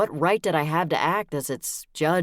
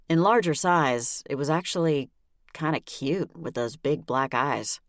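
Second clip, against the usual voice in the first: ordinary delivery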